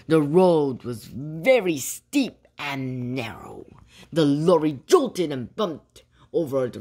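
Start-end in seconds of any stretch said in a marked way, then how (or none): none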